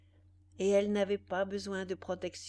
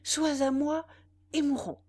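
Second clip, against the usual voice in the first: passionate outburst; 7 semitones higher, 6 dB louder than usual. A narrator's reading.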